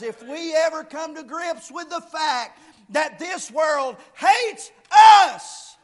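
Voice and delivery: loud, high, urgent pulpit delivery; preacher pressing a warning home; impassioned preaching. Real, not acted. Preaches at full force.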